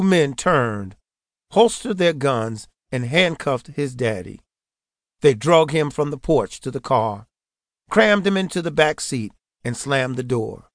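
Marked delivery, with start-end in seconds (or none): none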